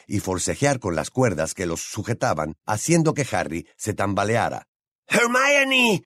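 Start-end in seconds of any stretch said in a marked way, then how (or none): 5.1-6.0 very high, desperate screech of a name; acted frantic high scream. A narrator's reading. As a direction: shriek the name in panic, holding it out. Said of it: "chilló Ron".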